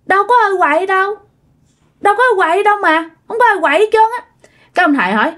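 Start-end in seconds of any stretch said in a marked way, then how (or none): 0.1-4.2 put-on high, innocent little-kid denial; imitated child voice, pitched up; child denying mischief. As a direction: protest innocence like a caught child. Scared child spirit denies mischief.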